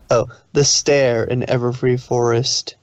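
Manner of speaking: usual speaking voice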